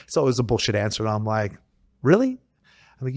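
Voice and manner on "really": high, rising incredulous question; retold disbelief. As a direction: question the excuse as if you can't believe it. Retelling disbelief at a flimsy excuse.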